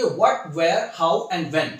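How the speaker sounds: very slow, word by word; slow model read of a title. Shown as a demonstration. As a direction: read each word out slowly for learners to copy. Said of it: "मैं इसकी आपको reading करके बताऊंगा".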